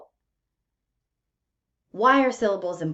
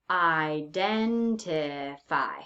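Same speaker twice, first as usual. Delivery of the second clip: IDENTIFY drawn out slowly, beat by beat; slowed-down word; 3 semitones lower, much slower than usual. Shown as a demonstration. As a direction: say "identify" slowly, separating each syllable so every beat is heard. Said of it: "I have to slow down the word so that I can hear".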